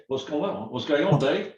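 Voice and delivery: normal talking voice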